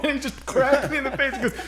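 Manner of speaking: usual speaking voice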